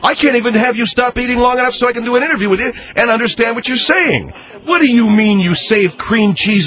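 fast, high, fed-up rant; losing all patience; exasperated. Acted. Interviewer loses patience.

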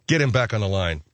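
usual speaking voice

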